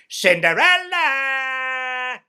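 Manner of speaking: high, very slow drawn-out call. Retold or imitated. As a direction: sing her name across the house. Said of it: "I called".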